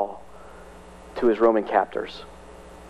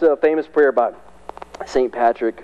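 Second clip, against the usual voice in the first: normal talking voice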